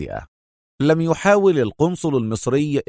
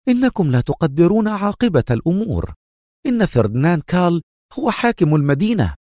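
high-pitched, agitated consul; tense; 6 semitones higher than usual. A narrator's reading. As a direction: argue anxiously in a raised pitch. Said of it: "قال القنصل في توتر".

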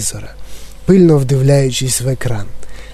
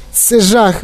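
mild higher-pitched lament; a touch of despair, pitched up; wailing; 6 semitones higher than usual. A narrator's reading. Mother lamenting her shrunken son, mildly wailing. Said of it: "голосила пані Ті Ві".

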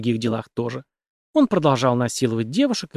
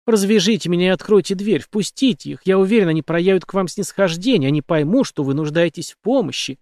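plain reading voice

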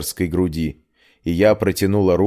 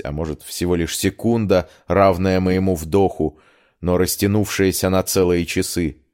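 ordinary delivery, nothing marked

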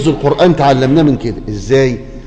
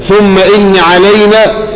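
tajweed recitation; 4 semitones higher, much slower than usual. Acted. Quran recitation opening.

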